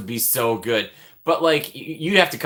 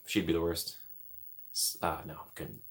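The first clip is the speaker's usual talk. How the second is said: ordinary delivery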